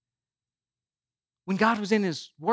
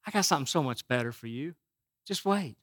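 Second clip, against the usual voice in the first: lower, softer, patient voice of God; a calm, soft God reassuring his child; 6 semitones lower, 8 dB quieter than usual. Retold or imitated. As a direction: answer gently from above, lower and softer. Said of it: "And God said".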